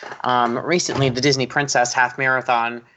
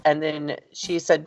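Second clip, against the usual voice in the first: usual speaking voice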